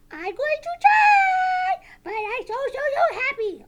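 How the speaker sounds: high, loud puppet wail of joy; overexcited puppet Sally. Pretend. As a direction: squeal it, stretched out with glee. Puppet Sally overjoyed to try.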